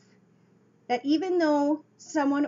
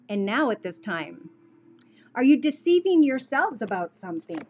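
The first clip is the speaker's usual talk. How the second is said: ordinary talk, nothing marked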